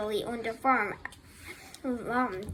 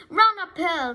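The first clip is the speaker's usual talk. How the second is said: high, loud urging cry; raised storybook shout; sheep's cry; 6 semitones higher, 7 dB louder than usual. A narrator's reading. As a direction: cry it out to a runaway. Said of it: "cried the sheep".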